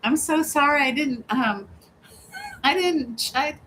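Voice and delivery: mock embarrassed apology. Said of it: "I'm like".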